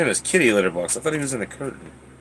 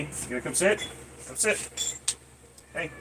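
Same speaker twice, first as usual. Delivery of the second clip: soft coaxing; 3 semitones higher, 14 dB quieter than usual. Real, not acted. Coaxing cat quietly.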